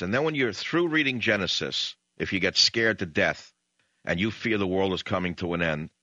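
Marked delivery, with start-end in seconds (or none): none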